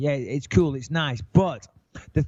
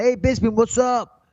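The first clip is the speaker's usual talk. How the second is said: eager fan; 6 semitones higher than usual. Retold or imitated. Airport fan calling out to Bisping, a lightly voiced eager shout. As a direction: hail him like an excited stranger.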